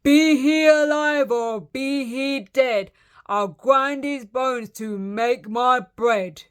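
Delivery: slow, loud, menacing giant rhyme; giant's shout. A narrator's reading. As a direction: stretch out the threat, big-voiced. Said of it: "he shouted".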